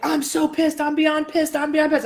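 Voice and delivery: high, mock-furious rant without volume; retold yelling. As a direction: repeat it in a worked-up high pitch. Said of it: "I'm yelling, yelling".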